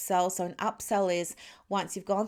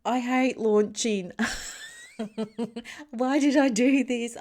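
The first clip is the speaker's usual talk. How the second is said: louder, higher mock complaint to the coach, with a laugh; exasperated whine; 5 semitones higher, 5 dB louder than usual. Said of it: "saying to my coach".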